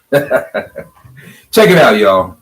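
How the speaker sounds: laughing. Real, not acted. Laughs while talking.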